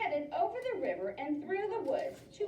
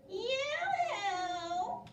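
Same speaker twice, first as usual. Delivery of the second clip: high singsong call; calling yoo-hoo; 6 semitones higher than usual. Acted. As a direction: call across the meadow. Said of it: "she shouted".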